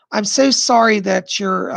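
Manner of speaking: ordinary talk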